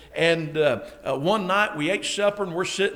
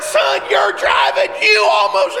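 fighting; 12 semitones higher, 7 dB louder than usual. Retold or imitated. Father fighting with son in the parking lot, shouting. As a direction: shout back, stretching the words in outrage. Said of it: "we're fighting in the parking lot".

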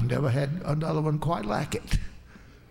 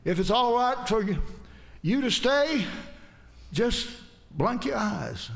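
urgent plea; 7 semitones higher than usual. Retold or imitated. Preacher begging the woman to stay, higher urgent plea. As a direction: push the plea up with rising hope. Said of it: "turned it around and I said".

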